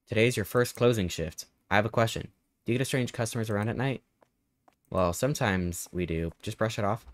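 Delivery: normal talking voice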